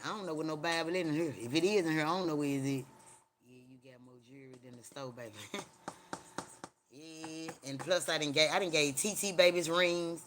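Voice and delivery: ordinary talk, nothing marked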